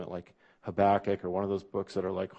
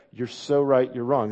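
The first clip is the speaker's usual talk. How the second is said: louder smug know-it-all jab; self-righteous retort; 3 semitones higher, 8 dB louder than usual. Shown as a demonstration. Preacher acting out a smug know-it-all, louder. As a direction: bark it like you're sure you're right. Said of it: "Like that".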